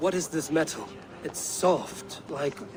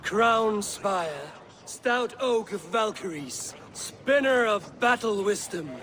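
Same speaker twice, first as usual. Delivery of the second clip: slow, raised-pitch proclamation; ceremonial toast; 4 semitones higher, slower than usual. Acted. Guest raises a horn to the new king, solemnly proclaiming.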